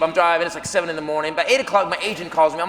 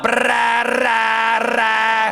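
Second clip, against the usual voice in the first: rattling engine noise; much slower than usual. Retold or imitated. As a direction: imitate a broken air conditioner grinding. Imitating air conditioner noise.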